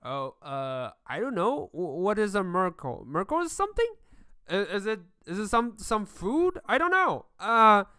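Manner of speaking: confused chatter. Acted. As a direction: babble in rising confusion. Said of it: "having this conversation like".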